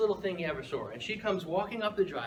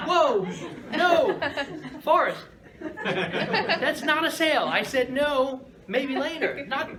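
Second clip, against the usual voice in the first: higher, louder, slow flustered calling after him; alarmed protest; 10 semitones higher, 7 dB louder, slower than usual. Retold or imitated. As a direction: stop him before he runs off. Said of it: "I'm like".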